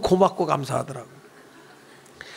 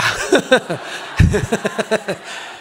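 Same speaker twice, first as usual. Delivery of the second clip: laugh. Real, not acted. Pastor laughs at himself.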